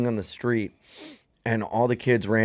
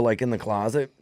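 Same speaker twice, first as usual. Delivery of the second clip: normal talking voice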